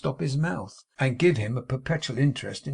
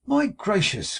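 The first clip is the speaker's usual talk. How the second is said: high, louder cry of surprise; surprised cry; 9 semitones higher, 4 dB louder, slower than usual. A narrator's reading. Charity spotting Tom by surprise, a high startled cry. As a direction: exclaim in startled delight. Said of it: "cried a well-known voice".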